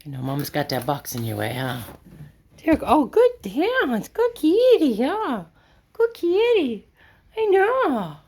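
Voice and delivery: very high, slow sing-song pet voice. Real, not acted. Talking to Kitty Blaze in the loft, high cooing pet voice. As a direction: coo sweetly up at the kitty. Baby talk to the cat.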